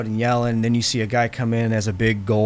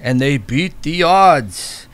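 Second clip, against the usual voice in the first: slow, grand storyteller voice; epic narration; 6 semitones higher, 6 dB louder, slower than usual. Acted. The game master recites the legend to the players, grandly. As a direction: intone it like an ancient legend.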